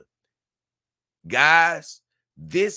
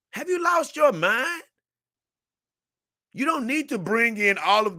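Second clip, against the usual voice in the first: incredulous; 11 semitones higher than usual. Real, not acted. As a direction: ask as if they're crazy. Disbelief at viewer's choice.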